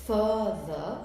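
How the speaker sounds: "Further" in two clear beats, fur-ther; split into syllables. Shown as a demonstration. Teacher repeating for the class, "Further" cut in two syllables. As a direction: break "Further" after the first syllable. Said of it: "this is the way you cut it".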